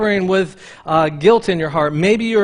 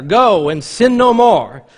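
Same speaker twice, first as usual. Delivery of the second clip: a louder, slow preachy scolding; mock preachy; 3 semitones higher, 7 dB louder, slower than usual. Jesus preaching at the woman caught in adultery, mock preachy. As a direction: lecture her with it. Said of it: "he's getting preachy with her".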